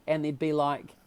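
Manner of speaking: ordinary talk, nothing marked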